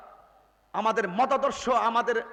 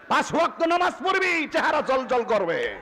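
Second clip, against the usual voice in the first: booming exhortation; 5 semitones higher, 6 dB louder than usual. Real, not acted. Preacher exhorting the youth.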